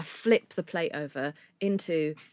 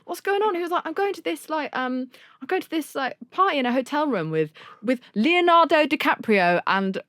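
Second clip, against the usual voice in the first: higher imitated voice, eager; light impression of a rock star; retold invitation; 7 semitones higher than usual. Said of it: "and he's like".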